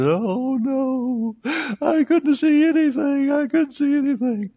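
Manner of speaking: put-on high, slow, fragile old woman's voice; old lady voice. Preacher quoting the healed old lady in a high, slow voice.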